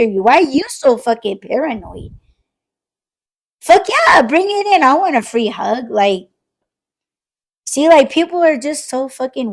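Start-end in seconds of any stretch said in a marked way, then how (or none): 3.6-5.9 eager hug welcome. Pretend. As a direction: invite the hug like it's the best news. Playing someone welcoming a hug.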